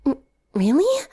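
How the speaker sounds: surprised stammer. A narrator's reading. Twilight stunned by the compliment, stammering in surprise. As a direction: catch your breath, then ask. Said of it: "eyes filled with surprise".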